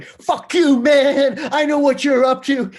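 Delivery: imitating furious yell. Guitarist yells at the producer asking for melody, mock furious shout. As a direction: bark it back like a guitarist losing it.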